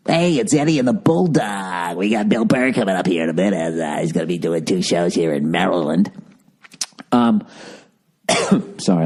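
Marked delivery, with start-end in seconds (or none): 0.1-6.1 lightly hammed-up radio host patter; a hint of a cheesy drive-time DJ; bad morning DJ voice. Mocking the early caller with a bad morning DJ voice. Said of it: "that was a bad morning D J voice".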